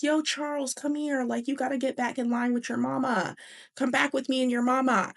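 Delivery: high, acted call to a kid; calling over. Man calls boy back to his mama, calling over.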